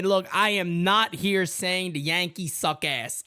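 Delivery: normal talking voice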